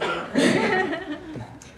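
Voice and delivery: shy giggle, caught off guard; embarrassed laugh. Real, not acted. Student laughing shyly when called on to answer. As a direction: laugh it off nervously. Nervous student put on the spot.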